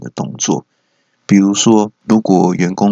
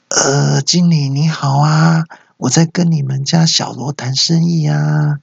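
high, drawn-out phony client; put-on, stretched high voice; fake client voice; 6 semitones higher, slower than usual. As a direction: disguise your voice as a client greeting a manager. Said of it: "还扮演客户".

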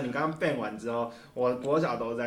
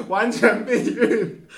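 laughing; 10 semitones higher, 10 dB louder, much slower than usual. Real, not acted. Punchline laughing.

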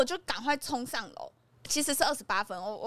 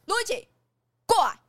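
higher, louder call down the hall; 9 semitones higher, 8 dB louder than usual. Retold or imitated. Brother calls Luigi back down the hallway, loud call. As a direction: summon him back sharply. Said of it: "他就说：".

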